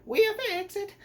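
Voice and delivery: high, loud cartoon Italian accent; exaggerated Italian accent. Reviewer imitating the movie's commercial, very high Italian accent. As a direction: sell it like a hammy Italian commercial. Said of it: "where they're doing their accents".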